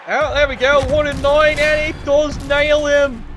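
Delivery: excited play-by-play. Real, not acted. Commentator calling a lucky hit, excited.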